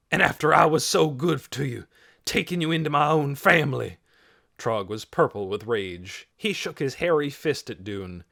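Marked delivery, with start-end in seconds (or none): 0.1-4.0 loud, higher outraged accusation; angry reproach. A narrator's reading. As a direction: throw the betrayal in the boy's face. Furious man accuses the boy.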